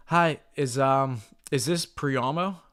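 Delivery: hesitant, slow, unsure question; nervous caller. Shown as a demonstration. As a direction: stumble like a timid caller. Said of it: "This is how most people call ... nervous".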